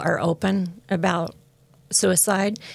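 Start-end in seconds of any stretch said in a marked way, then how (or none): none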